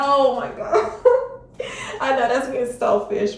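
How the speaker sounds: laughing through the words at herself; giddy nervous laugh; nervous laughter. Real, not acted. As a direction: giggle it out, a bit embarrassed. Laughs at her own wish.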